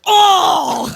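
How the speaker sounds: high anguished mock cry; hammed-up horrified outburst; John Lithgow. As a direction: wail as if watching things get smashed. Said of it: "John Lithgow's responses".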